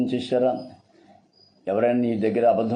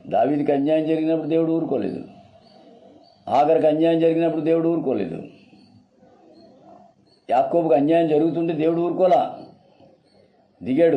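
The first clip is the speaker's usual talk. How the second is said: raised, sustained preaching pitch; impassioned preaching; 5 semitones higher than usual. Real, not acted. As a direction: proclaim it to the congregation, pitch held up. (ఆవేశపూరిత ప్రసంగం)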